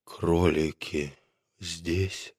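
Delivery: doctor coming round after fainting; weak and faint. A narrator's reading. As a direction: ask with almost no strength left.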